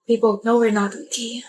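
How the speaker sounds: unvoiced hushed admission; resigned whisper; whispered sadness. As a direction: whisper the hard truth. Sad breakup talk, whispered.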